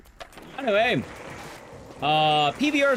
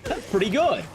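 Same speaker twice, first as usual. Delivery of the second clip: pitched-up dawning approval; pleasantly surprised; 9 semitones higher than usual. Retold or imitated. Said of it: "I thought to myself".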